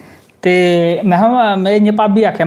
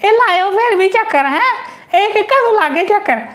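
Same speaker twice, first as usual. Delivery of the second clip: high, pleading complaining voice; whiny guest voice; 12 semitones higher than usual. Retold or imitated. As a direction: whine it like a reluctant guest. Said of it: "मन आखदे".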